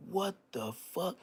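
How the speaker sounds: high, slow startled gasp of a question; reliving the shock in the mirror. Retold or imitated.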